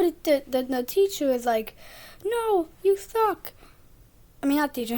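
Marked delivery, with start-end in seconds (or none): 2.2-3.5 put-on whiny kid voice. Jack's whiny retort.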